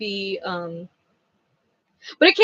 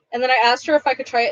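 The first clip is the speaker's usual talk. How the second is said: normal talking voice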